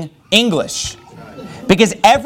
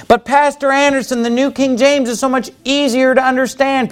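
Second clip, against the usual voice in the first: raised mocking voice of a critic; mock objector; 7 semitones higher than usual. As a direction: imitate the whiny complainer, pitched up. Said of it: "people will tell you".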